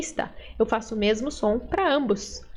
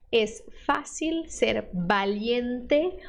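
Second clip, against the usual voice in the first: slow reading; much slower than usual. Shown as a demonstration.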